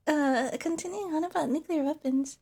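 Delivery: ordinary delivery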